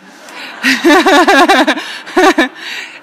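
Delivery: loud, high burst of laughing; hearty laugh. Real, not acted. Speaker to audience after revealing the twist, hearty laugh. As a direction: laugh out loud in a long peal.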